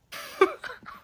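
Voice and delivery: sudden high burst of disbelieving laughter. Real, not acted. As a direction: laugh out in shock at the nerve. Reacting to his kissing advice.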